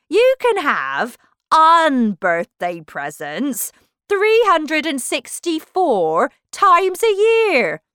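loud, slow, emphatic character; pompous Humpty Dumpty. Acted. Humpty Dumpty lecturing Alice on unbirthdays, pompous and loud. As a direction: lecture grandly, very loud, stretching key words. Narrator voices Humpty explaining.

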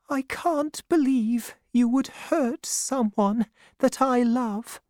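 high-pitched, wounded mother's voice; sad. A narrator's reading. Mother reproaches Davy for hurting someone, sadly. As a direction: reproach gently in a high, sorrowful voice. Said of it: "she said sadly".

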